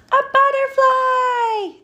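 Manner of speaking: put-on high, loud children's cry; excited shout. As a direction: shout it with delight. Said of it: "the children shouted".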